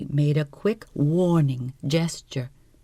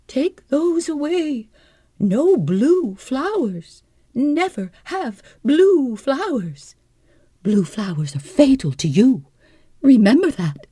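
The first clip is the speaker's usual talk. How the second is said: cried-out warning; 11 semitones higher, 7 dB louder than usual. Acted. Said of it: "She pointed at them and cried out".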